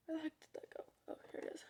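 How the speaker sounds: under-the-breath aside, almost whispered; muttered aside. Real, not acted. Searching for something mid-story, quiet whispered aside to self. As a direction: say it very softly to yourself.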